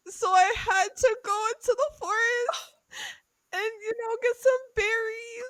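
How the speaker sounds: high, crying plea; tearful sob story. Acted. Ellie pleading to the tower owner, crying. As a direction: whimper out the poor-family tale. Poor girl's sad story.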